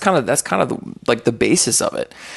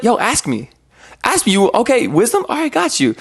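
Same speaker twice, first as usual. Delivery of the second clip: God's voice pitched higher, casual and eager; voicing God; 10 semitones higher than usual. God answering a believer, voiced high and eager. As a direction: play God eagerly inviting the question. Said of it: "But God's like".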